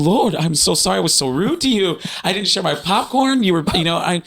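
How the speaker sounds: high-pitched tearful pleading, acted; retold crying. Apologizing to the Lord in the car, tearful. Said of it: "I'm crying and I'm like".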